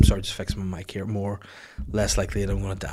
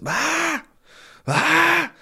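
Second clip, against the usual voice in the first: high, loud imitated baby shriek; baby screaming; 7 dB louder than usual. Imitating the new baby that won't settle, high screams. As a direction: screech like a screaming baby, not crying. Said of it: "start just screaming, not even crying".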